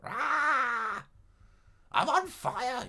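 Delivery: high, loud mock scream of a patient; hammed-up high screaming madman. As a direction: shriek it like a madman. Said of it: "just people screaming".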